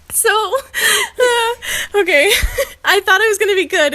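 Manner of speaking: high, fast, laughing near tears; giggling breakdown. Real, not acted. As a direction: try to hold it together and fail. Losing composure while reading.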